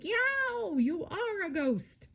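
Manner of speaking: very high, drawn-out yelp; hammed-up frightened squeal; yelled. A narrator's reading. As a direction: wail in mock terror, squealing upward. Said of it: "Morris yelled".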